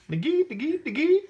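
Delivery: high silly laugh; lion laughs at the iguana's sticks. A narrator's reading. High laughing lion. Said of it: "laughed the lion".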